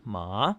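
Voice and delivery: MA rising like a question; questioning upturn on MA; second tone. Shown as a demonstration. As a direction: ask MA, pitch sliding upward. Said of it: "like you're asking a question".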